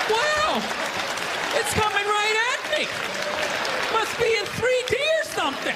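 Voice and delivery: high, awestruck and oblivious; dazed wonder. Retold or imitated. Bystander gawking at the charging player, amazed. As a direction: marvel like at a 3D movie. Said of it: "like you're watching a movie".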